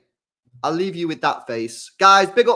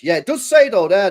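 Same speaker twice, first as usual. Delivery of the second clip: surprised discovery; 6 semitones higher, 6 dB louder than usual. Real, not acted. Spots it in the text.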